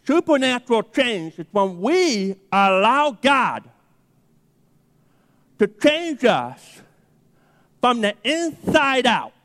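slow, emphatic sermon delivery; slow deliberate preacher voice; preaching. Acted. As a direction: drive the point home from the pulpit. Preaching the point.

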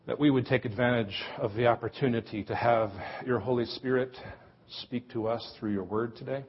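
soft, hushed prayer voice. Acted. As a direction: pray quietly and reverently. Leads the congregation in prayer.

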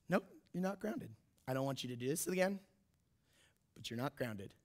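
low, soft, measured imitation of mom; pause, then deliver it gently and low; quiet verdict. Mom sparing her son punishment, imitated quiet calm.

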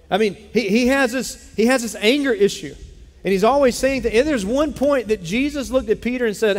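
preaching at full force; fervent preaching. Acted. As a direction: build the plea up like a pulpit cry.

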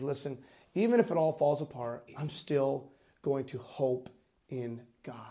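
quiet, solemn conviction; hushed resolve. Retold or imitated. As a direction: let it sink softly into a whisper. Preacher hushes for the prophet's resolve.

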